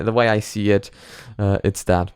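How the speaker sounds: normal talking voice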